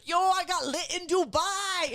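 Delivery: high, drawn-out mock party brag; hammed-up child bragging, stretched and high; imitating redheaded child. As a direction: shout it like a hyped-up kid. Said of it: "this nine-year-old redheaded child".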